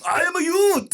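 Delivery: put-on high shouted Japanese accent. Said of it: "but with a Japanese accent".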